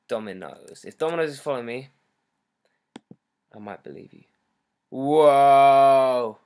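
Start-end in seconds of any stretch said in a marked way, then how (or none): 4.9-6.4 loud, drawn-out whoa of amazement; big starstruck exclamation; amazed whoa. Real, not acted. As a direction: belt out one long stunned whoa. Amazed at sudden follow.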